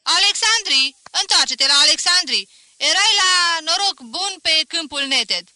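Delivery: loud, high mocking yell; rowdy heckler's taunt; heckler shouting. A narrator's reading. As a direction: jeer at him from the gallery. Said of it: "un haidamac de la galerie strigă".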